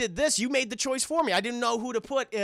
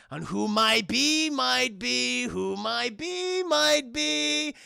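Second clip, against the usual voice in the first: very slow, sing-song musing; creepy villain drawl; 3 semitones higher, much slower than usual. Retold or imitated. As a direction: savor the threat.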